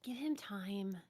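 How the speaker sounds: soft unvoiced aside; whispering. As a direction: breathe it under your breath.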